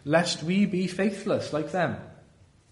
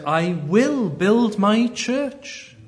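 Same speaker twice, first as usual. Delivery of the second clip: slow, high proclamation; 7 semitones higher, 4 dB louder, much slower than usual. Acted. Quoting God's promise.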